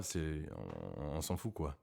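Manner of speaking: voix française plus grave et plus douce. Shown as a demonstration. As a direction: sink the voice down for the French. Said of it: "I think I would lower my voice".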